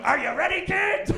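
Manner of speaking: hammed-up cartoon call; mock cartoon captain. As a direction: call out like the SpongeBob theme captain. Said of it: "I'm like".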